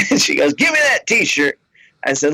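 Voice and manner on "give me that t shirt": higher, insistent fan voice; tipsy fan. Retold or imitated. Fan pressing the comic about her ex, higher. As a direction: lean in, sure he knows.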